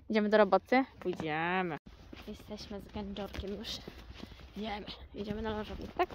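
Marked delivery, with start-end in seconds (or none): none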